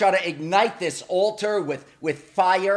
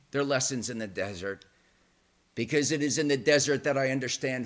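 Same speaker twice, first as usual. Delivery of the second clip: sincere low confession; 5 semitones lower, 6 dB quieter than usual. Real, not acted. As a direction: drop low and soft, speak from the heart. Quiet heartfelt sermon confession.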